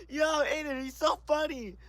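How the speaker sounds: high, soft, laugh-choked voice; laughing while talking. Real, not acted. Vlogger telling viewers the friend is so funny, laughing. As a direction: squeeze the words out through laughter.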